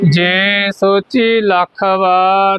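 slow, drawn-out phrase-by-phrase recitation; scripture recitation. Shown as a demonstration. Teacher leading learners through the scripture, slow measured recitation. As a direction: stretch each word ending, leave room for repetition.

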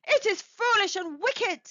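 high, loud anxious objection; faltering protest. A narrator's reading. Husband resisting his wife's command, faltering. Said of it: "he faltered".

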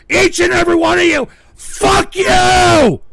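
screaming fuck you in full rage; real raging yell; furious shout. Real, not acted. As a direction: bellow it at the whole chat. Raging at chat.

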